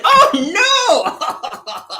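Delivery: high, drawn-out laughing cry; playful "oh no" through laughter; laughing mock dismay. Mock dismay at wet bed.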